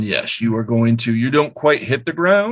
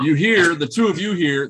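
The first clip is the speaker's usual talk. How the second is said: ordinary delivery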